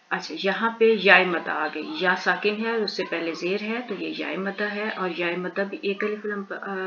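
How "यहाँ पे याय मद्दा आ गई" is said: normal talking voice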